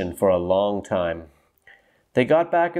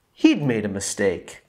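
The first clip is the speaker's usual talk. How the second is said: plain reading voice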